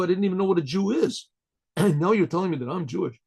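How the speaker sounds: ordinary talk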